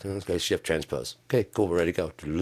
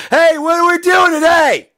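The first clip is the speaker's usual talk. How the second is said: much higher and louder, like caps lock; "Hey, what are we doing today?" as a much higher, louder shout; all-caps shout; 14 dB louder than usual. Shown as a demonstration. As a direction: yell it the way caps text reads. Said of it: "I'm in lowercase".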